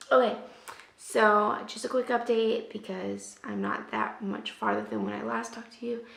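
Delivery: usual speaking voice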